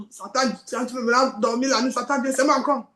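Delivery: ordinary delivery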